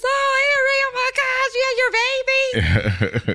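high mock-thrilled older man on the phone; imitating delighted Mister Bill. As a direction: gush over the baby news. Voices Mister Bill's delighted reply.

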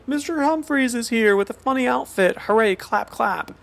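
a hammed-up sitcom cheerleader; mock cheery announcer. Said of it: "to be like".

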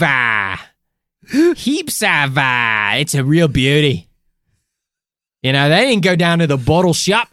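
put-on Aussie voice, hammed-up; mock Australian accent. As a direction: lay on a cheesy Australian accent. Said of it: "we know you love this authentic Australian accent".

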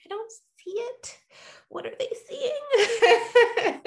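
playful self-mocking bafflement; mock puzzlement. Mocking own confusion.

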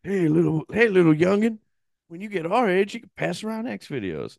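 put-on high, hammy creepy old man. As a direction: lure a kid like a creep. Imitating creepy old men.

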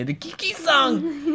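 put-on high, slow Mexican accent with a laugh. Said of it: "That's how Mexicans say".

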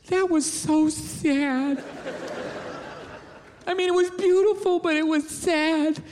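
slow, drawn-out mock sadness; imitated tearful wife. Wife on his shoulder after the video, mock-sad and drawn out. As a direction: stretch the words out, mock-moved.

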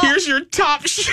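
high, slow, sassy handoff; hammed-up sassy flight attendant. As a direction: serve it with mock grandeur. Sassy flight attendant hands cheap vodka.

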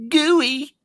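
high squeaky goofy answer. Pretend. Answering the quiz word goad with a squeaky high voice. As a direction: pitch it way up, gooey and silly.

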